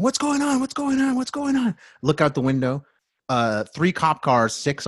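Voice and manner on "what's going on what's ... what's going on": alarmed onlookers. Retold or imitated. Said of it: "people going".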